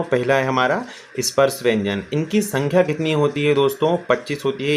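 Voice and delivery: ordinary talk, nothing marked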